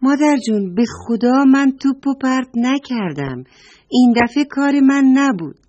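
put-on high, loud child's protest; pleading child. Naughty boy Namaki protests his innocence to his mother. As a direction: swear you didn't do it. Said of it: "با دیدن مادرش گفت".

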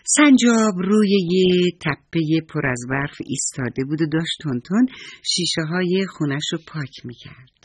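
plain reading voice

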